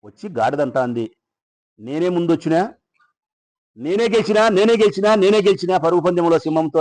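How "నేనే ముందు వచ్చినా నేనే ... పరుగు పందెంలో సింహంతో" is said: high, loud gloating that builds on each repeat; boastful donkey. Acted. Donkey gloating to the silent lion, high and loud. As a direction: crow about winning, louder each time. Donkey brags after race.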